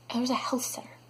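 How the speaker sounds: whispered, flat disbelief; deadpan whisper. Real, not acted. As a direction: let it drop to a whisper of defeat. Deadpan reveal of wrong place.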